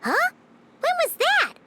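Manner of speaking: high, startled question; surprised. Acted. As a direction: squeak it out in surprise. Paimon caught off guard.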